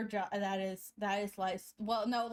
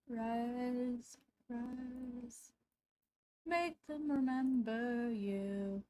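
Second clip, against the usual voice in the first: dramatic epic line; much slower than usual. Acted. Dramatic quote while drawing Susan.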